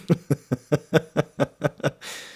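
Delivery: long, high staccato laugh. Real, not acted. Streamer noticing how late it is, long staccato laugh. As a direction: laugh it out in a steady string of bursts. Cackles after noticing the time.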